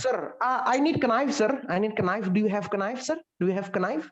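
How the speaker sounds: "knife" with a voiced K, "kuh-naif"; mispronounced knife. Student asking Sir for a knife, mispronouncing it "kuh-naif". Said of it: "Jangan knife".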